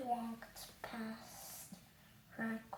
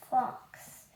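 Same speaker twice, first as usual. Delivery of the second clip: usual speaking voice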